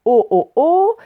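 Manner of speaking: loud closed "oh, oh, oh"; « oh » de monsieur O. Shown as a demonstration. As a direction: repeat a round "oh", loud and drawn out. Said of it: "donc il disait". (« oh » fermé, fort et répété)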